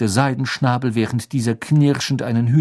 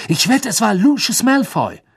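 high, emphatic outburst; excited accusation; 12 semitones higher, 4 dB louder than usual. Acted. Ron bets on the culprit, excited outburst. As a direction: accuse with a high, eager cry.

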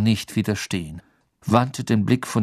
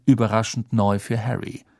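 usual speaking voice

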